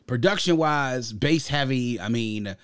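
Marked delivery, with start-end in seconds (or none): none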